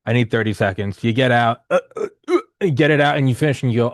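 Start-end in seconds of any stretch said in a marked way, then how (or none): 1.7-2.4 high-pitched imitated heaving; short, high mock gagging sounds; mock retching. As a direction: imitate throwing up in short heaves. Retching while retelling throwing up.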